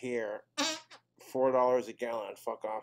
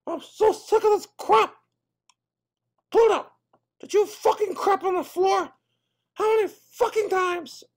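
very high squeaky voice, loud and furious; a cartoon mouse losing his temper; angry Mickey Mouse; 7 dB louder than usual. Mickey yelling at Pluto.

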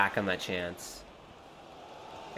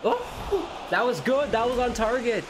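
thrilled cry; 11 semitones higher than usual. Real, not acted. Gamer pleased by his own shot, excited high cry. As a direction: squeal with joy at the shot.

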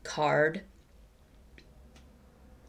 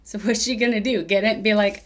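normal talking voice

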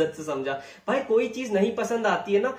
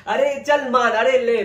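loud, higher mocking dismissal; jeering at the rapper's threats, loud and scornful; scornful scoff; 5 semitones higher, 6 dB louder, slower than usual. Real, not acted. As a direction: brush him off with a loud jeer.

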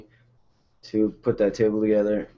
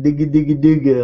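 put-on baby babble; 4 semitones higher, 7 dB louder, faster than usual. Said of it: "she's got me saying".

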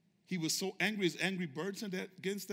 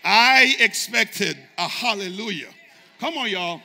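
loud prodding; 2 semitones higher, 18 dB louder than usual. Real, not acted. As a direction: hit a long, loud opening word. Preacher prodding congregation for response.

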